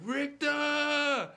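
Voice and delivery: very high, loud drawn-out yell; movie-quote yell. Retold or imitated. Friend randomly quotes Total Recall to crack friends up, mock yell. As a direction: holler the villain's name, hammed-up. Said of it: "randomly yell out shit from the movie".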